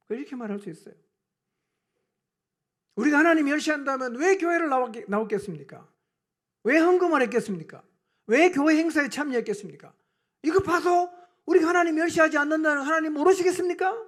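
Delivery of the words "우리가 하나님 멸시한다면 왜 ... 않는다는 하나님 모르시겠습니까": loud, mounting protest; indignant retort. Acted. Congregation talking back to God, in indignant protest. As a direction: throw back indignant rhetorical questions, building louder and higher. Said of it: "이렇게 반문하고 싶을 수 있어요".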